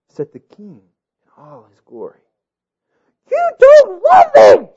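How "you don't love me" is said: high, slow tearful scream. A narrator's reading. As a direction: scream it high through tears. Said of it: "she screamed as tears".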